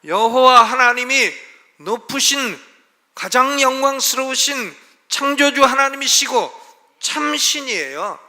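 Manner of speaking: loud, high proclamation, 참 신이에요 slowed; fervent pulpit shout of praise; preaching at full force. Acted. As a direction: cry out God's majesty to the congregation. Preacher proclaims God's glory.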